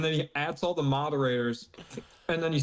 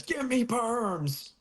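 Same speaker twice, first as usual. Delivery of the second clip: high, drawn-out whine for perms; hammed-up slow demand; mock demand; 7 semitones higher, much slower than usual. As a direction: stretch it out high, pleading comically. Exaggerated re-reading of the demand.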